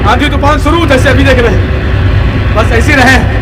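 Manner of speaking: high, loud storytelling sermon; full-force preaching. Acted. Preacher telling the crowd of the enraged Narasimha, full-force preaching.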